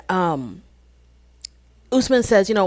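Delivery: ordinary talk, nothing marked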